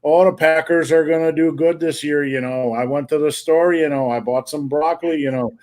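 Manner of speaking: imitating an aunt's Wisconsin accent. Nephew mimics his aunt's small talk, mock Wisconsin accent. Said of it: "that's literally how my aunt talked".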